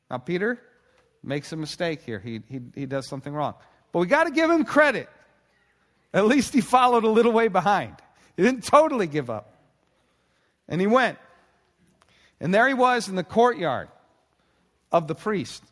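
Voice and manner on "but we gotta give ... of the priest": loud, high, driving preacher voice; full-force preaching. Acted. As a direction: preach it out with rising fervour.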